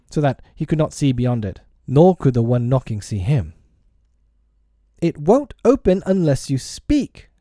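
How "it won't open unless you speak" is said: put-on high-pitched boy calling out; boyish cry. A narrator's reading. Peter to the silent visitor at his door, high cry. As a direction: call it high, as through a door. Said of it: "Peter cried".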